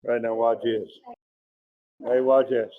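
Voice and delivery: ordinary talk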